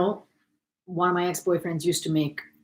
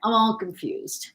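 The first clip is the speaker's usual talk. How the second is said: slow, drawn-out; 5 semitones higher, slower than usual. Real, not acted. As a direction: drag it out playfully. Repeated slowly, louder.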